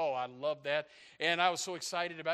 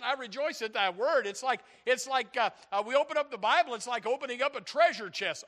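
raised, louder preacher's delivery; higher, punchy pulpit proclamation; fired-up preaching; 6 semitones higher, 7 dB louder than usual. Real, not acted. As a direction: preach it with rising excitement.

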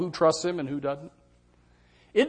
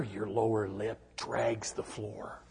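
mock gloom; 7 semitones lower, 10 dB quieter, slower than usual. Real, not acted. Mocking sulky face, slow low.

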